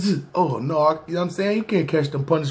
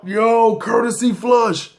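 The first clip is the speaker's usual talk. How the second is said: high, drawn-out acted holler; insistent mock demand; calling out in complaint; 7 semitones higher, slower than usual. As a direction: call across the cell, stretching the words. Cellmate calling out about the smell.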